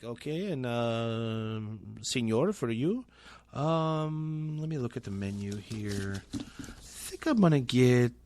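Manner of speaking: put-on gringo ordering with an overdone Spanish accent; exaggerated Spanish accent.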